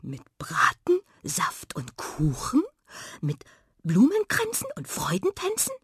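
breathy and tentative, climbing high; hopeful question. Acted. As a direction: ask item by item, hardly daring to hope. Friends ask hopefully about the feast. (zaghaft hoffend gehaucht)

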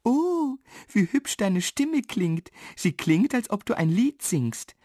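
put-on high, enchanted girl's voice; high-pitched delighted admiration; admiring girl. A narrator's reading. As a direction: marvel with a long high opening. Girl admires dwarf's pretty voice.

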